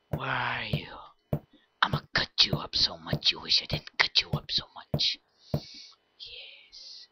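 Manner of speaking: soft creepy whisper; stalker whisper. Acted. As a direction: whisper threats while hunting.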